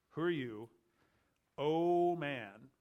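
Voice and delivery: "O man" drawn out with weight; emphatic. Shown as a demonstration. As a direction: lean long and hard on "O man". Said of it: "with emphasis".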